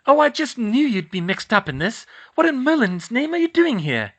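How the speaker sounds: high, loud exasperated mum; scolding mother. Acted. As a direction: scold them, worried and cross.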